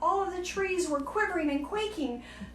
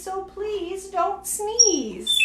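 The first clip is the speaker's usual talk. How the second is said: slow, higher plea; slow pleading; 6 semitones higher, much slower than usual. Acted. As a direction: drag it out, pleading. Hippo begging elephant.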